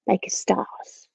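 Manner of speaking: soft, wondering whisper; hushed whisper. Real, not acted. Teacher showing children twinkling hands, hushed whisper. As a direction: whisper it like a little secret.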